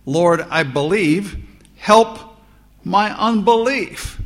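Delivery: loud pleading cry, rising high on help; anguished cry. A narrator's reading. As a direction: cry it out as a desperate father begging. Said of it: "cried out and said with tears".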